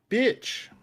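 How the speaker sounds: sharp high outburst at a miss; frustrated yell. Real, not acted. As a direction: blurt it out in real annoyance. Player misses with scimitar.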